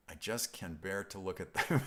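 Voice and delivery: breaking into laughter at the end; real laugh taking over the line; amused reading. Real, not acted. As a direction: read it out and crack up on the last word. Amused reading of viewer's question.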